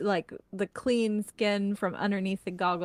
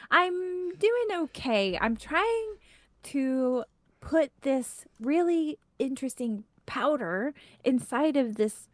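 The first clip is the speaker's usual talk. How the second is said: flattered gnome inventor; 5 semitones higher than usual. Acted. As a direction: play a tinkerer explaining her project, pitched up. Game master plays the gnome inventor.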